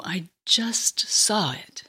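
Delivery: slow hushed whisper. Acted. Anna whispering to Simeon about her vision, slow whisper. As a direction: breathe it out slowly, awed. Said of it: "Anna would reply".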